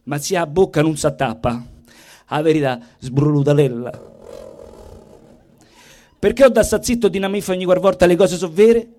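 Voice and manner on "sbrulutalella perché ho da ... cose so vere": normal talking voice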